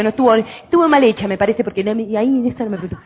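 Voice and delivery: ordinary talk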